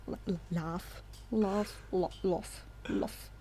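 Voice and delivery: "laugh" restarted, stuck on the l; stumbling over laugh. Real, not acted. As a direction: keeps tripping on "laugh". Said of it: "I cannot say laugh".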